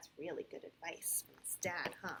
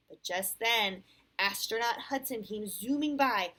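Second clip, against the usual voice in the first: clear, animated read-aloud; storybook voice with lively rises and falls; picture-book narration; 8 semitones higher, 13 dB louder than usual. A narrator's reading. Parent reads Sam's story to a child, animated storytelling.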